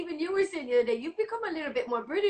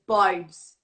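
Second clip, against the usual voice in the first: put-on vibes in a Brummie accent; Birmingham accent; 6 semitones lower, 8 dB louder than usual. Imitating how everyone in Birmingham says vibes, Brummie accent. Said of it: "everyone in Birmingham says".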